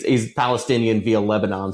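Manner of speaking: normal talking voice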